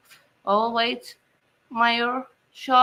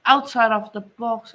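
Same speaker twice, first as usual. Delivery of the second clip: ordinary delivery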